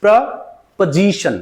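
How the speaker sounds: "प्रपोजिशन" slowly broken as "preh... puh-ZI-shun"; correct pronunciation. Shown as a demonstration. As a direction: say "प्रपोजिशन" in two slow parts, main stress on "ZI". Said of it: "So आप इसको बोलोगे".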